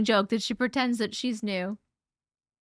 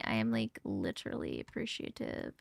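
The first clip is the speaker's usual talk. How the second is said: strained, much lower, fading voice; voice giving out; 14 semitones lower, 11 dB quieter than usual. Real, not acted. Worn-out streamer thanking gifters, voice failing. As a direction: let the voice crack and drop away. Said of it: "I am struggling over here".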